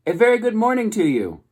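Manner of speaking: high, bright booming robot greeting; friendliest robot voice. A narrator's reading. Robot calling across the pond to the goose, high and cheerful. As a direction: boom it out cheerfully across the water. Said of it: "the robot's friendliest voice boomed".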